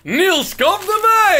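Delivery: loud high call across the room. Real, not acted. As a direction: shout the name to call him over. Calling someone over.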